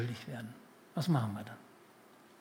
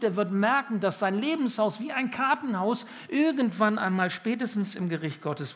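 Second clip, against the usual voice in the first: higher, louder building appeal; preaching with rising force; 7 semitones higher, 4 dB louder than usual. Acted. Preacher warning that a life built alone collapses, rising force. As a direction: build the warning toward a climax.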